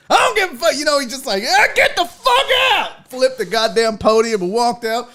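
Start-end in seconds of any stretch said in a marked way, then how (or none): 0.1-0.7 high, mock-furious outburst; hammed-up coach blowing up; losing his shit. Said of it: "He lost his shit". 1.5-3.1 high-pitched mock yell; hammed-up raging coach; losing his shit. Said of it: "He lost his shit".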